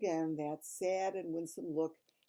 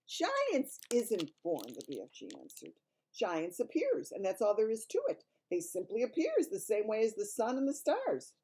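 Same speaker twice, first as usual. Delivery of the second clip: big friendly giant voice; kindly giant voice; BFG giant voice; 5 semitones higher, 5 dB louder than usual. Acted.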